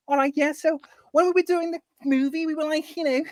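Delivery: high-pitched Liverpudlian impression; Jodie Comer. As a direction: imitate a chatty, strong Scouser. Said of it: "she's got a really strong Scouser accent".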